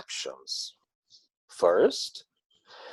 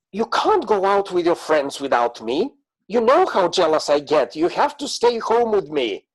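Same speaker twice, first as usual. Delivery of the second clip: louder, rising possessive pleading; jealous partner; 5 semitones higher, 4 dB louder than usual. Retold or imitated. Jealous partner forbidding going out with friends, lightly voiced possessive. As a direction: play a clingy partner keeping them home.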